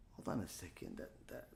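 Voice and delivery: very quiet and low, thinking aloud; barely voiced, as if talking to oneself; hushed dawning suspicion. Man puzzles to himself in story.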